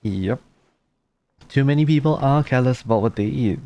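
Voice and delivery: usual speaking voice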